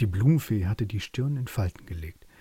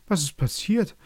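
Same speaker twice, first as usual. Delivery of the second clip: small creatures, high and alarmed; startled meadow critters, pitched up; meadow animals asking; 9 semitones higher, 3 dB louder than usual. A narrator's reading. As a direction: ask it as a startled little animal. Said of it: "fragten sie".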